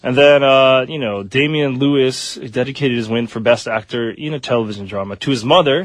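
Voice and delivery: ordinary delivery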